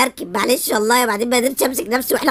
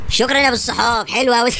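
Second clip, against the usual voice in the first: ordinary delivery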